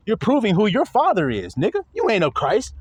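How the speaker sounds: normal talking voice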